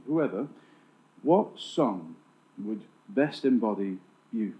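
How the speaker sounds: ordinary talk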